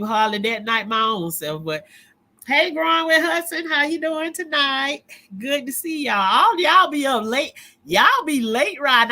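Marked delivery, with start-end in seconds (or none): 2.5-9.1 higher, lively hello to a viewer; cheerful welcome to the chat; excited greeting. Real, not acted.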